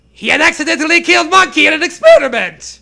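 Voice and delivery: high, loud put-on kid-genius voice; Dexter voice. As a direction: wail it like the boy scientist. Reading Dexter's lines aloud.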